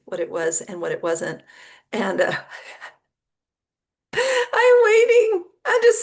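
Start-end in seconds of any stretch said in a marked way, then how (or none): 4.1-6.0 laughing through the words, pitched much higher. Real, not acted. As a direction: crack up while saying it. Laughing at her own fuss.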